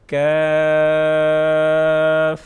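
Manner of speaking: کاف held very long and loud; drawn-out letter name. Shown as a demonstration. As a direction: stretch کاف slowly. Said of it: "next پڑھتے ہیں". (کاف کو لمبا کھینچیں)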